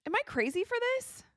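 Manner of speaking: high, exasperated question; incredulous. Real, not acted. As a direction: appeal in disbelief. Disbelief at coaching decision.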